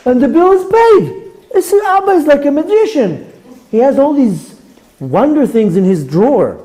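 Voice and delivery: put-on high childlike voice, marvelling at Abba; awed little girl. Eleven-year-old marvelling at her father's card, high wondering child voice. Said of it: "She says".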